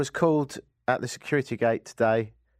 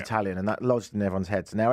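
ordinary talk

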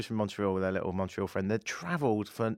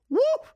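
sudden squealed high whoop, louder; falsetto whoop; 6 dB louder than usual. Retold or imitated. Mocking teen girls cheering his radio show, shrill falsetto whoop. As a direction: shriek like a fan girl.

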